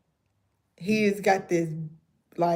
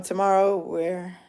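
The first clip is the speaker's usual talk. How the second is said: normal talking voice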